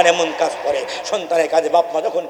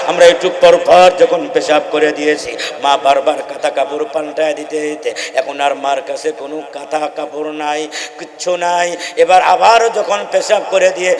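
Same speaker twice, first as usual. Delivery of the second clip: usual speaking voice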